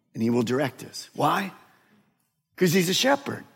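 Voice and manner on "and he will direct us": ordinary talk, nothing marked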